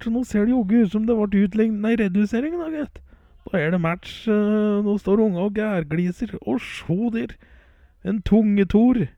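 high, loud, excited match commentary; live play-by-play. Real, not acted. Commentator calling the match to listeners, high and excited. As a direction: call the goal as it happens, voice up high.

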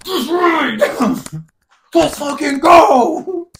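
high, slow triumphant yell; victory shout. Acted. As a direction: fist pump celebration. Imagined fist pump.

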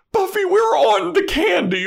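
very high, loud laughing wail; mother blurting her excuse; laughing mom. Retold or imitated. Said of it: "Buffy's mom just goes".

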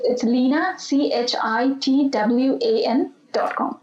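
slow, carefully spelled out letters; over enunciating. Shown as a demonstration. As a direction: spell each letter slowly and clearly. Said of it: "say that one more time slowly".

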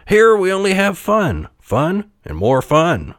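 high, excited, stepping down in pitch; lively jack-in-the-box. A narrator's reading. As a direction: promise fun with showman's bounce. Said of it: "said lively Jack-in-the-Box".

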